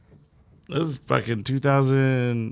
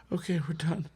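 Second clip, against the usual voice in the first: relieved, spent; 10 dB quieter than usual. Retold or imitated. Spent after painful tattooing.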